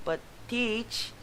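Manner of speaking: lightly voiced high whine; a hint of a bratty pupil; whiny student. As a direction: plead up high.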